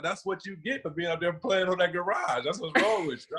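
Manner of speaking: high, mocking scold; imitated sister's scolding. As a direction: tell him off.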